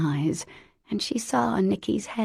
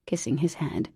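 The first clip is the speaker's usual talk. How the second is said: ordinary delivery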